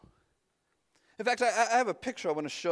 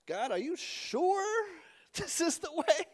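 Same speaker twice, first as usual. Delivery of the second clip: doubting prayer; 7 semitones higher, 3 dB quieter than usual. Pretend. Imagined prayer asking God.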